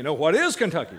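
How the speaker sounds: loud correction, leaning hard on "is"; stress on IS. Shown as a demonstration. Teacher corrects whoever just said Kentucky, loud stress on "is". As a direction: punch "is" to insist on the question form. Corrects answer not phrased as question.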